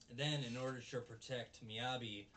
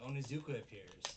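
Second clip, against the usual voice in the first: normal talking voice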